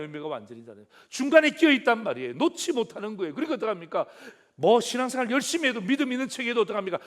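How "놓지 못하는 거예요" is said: ordinary delivery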